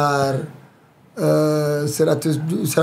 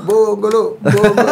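imitating a crowd's chant, higher and louder; teriakan orang ramai; 4 semitones higher, 4 dB louder, slower than usual. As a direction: call out the nickname like a cheering crowd. (menirukan sorakan orang ramai)

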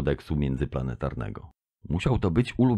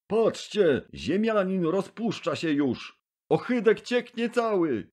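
very high, mocking crowd voice; jeering crowd; 12 semitones higher, 3 dB quieter than usual. A narrator's reading. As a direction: point and jeer at the sweating Earthling. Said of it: "W krąg huczało".

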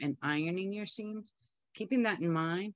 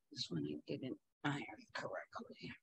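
muttered whisper; 11 dB quieter, faster than usual. Real, not acted. Muttering to herself while pinning.

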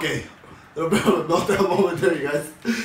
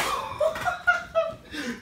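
squealing high laugh; 6 dB quieter than usual. Real, not acted. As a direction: burst into a high-pitched laugh. Laughing at opponent's injury.

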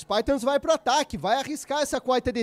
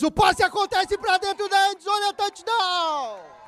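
touchdown call; 7 semitones higher than usual. Real, not acted. Narrator calls a touchdown pass.

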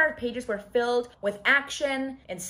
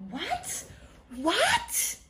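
high, rising squeaks of disbelief; stunned disbelief; 11 semitones higher than usual. Real, not acted. Reader hit by a plot twist, stunned disbelief.

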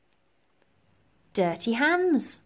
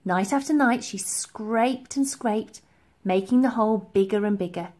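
ordinary delivery, nothing marked

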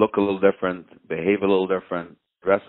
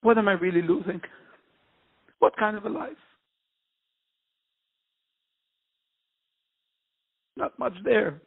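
high, strained voice on the verge of tears; real tears breaking through the words; 13 semitones higher than usual. Real, not acted. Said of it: "It's a little hard for me to talk".

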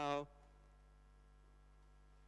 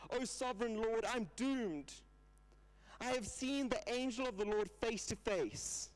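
higher, urgent, pleading cry of a doomed man; Gideon crying out; 5 semitones higher than usual. Retold or imitated. Preacher voicing Gideon crying out to God, high and pleading. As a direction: lift the voice as if terrified before God. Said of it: "Gideon cries out to God".

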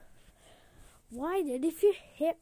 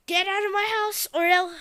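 higher loud threat; threat shout; 6 semitones higher, 9 dB louder than usual. Acted. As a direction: warn him one last time.

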